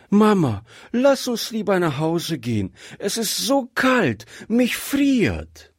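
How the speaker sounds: put-on high little girl's plea; pleading child. A narrator's reading. Freezing child begs mother to go home, pleading child.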